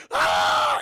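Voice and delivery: loud, sudden cry of pain; pained scream. Retold or imitated. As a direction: scream like you've been hit in the eye. Kid shot in the eye, retold.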